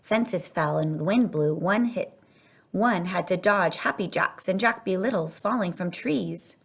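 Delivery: ordinary delivery, nothing marked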